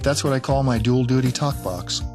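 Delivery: ordinary talk, nothing marked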